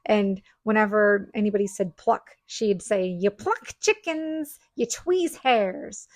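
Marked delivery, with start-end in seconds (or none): 3.1-6.1 slow, drawn-out scolding grandma; grandmotherly correction, very slowly; grandmother's voice. Retold or imitated. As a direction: imitate grandma correcting you, stretching each word. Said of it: "brings my grandmother's voice in my head".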